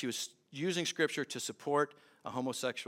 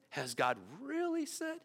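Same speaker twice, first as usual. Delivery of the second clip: "really" lifted high, questioning; sneering lift on "really"; mocking doubt on "really"; high stress on really; 9 semitones higher, 4 dB quieter than usual. Shown as a demonstration. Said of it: "However you want to put the emphasis there".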